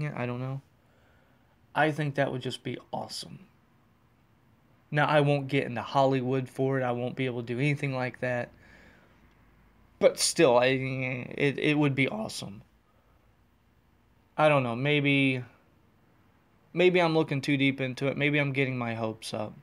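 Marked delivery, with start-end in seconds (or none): none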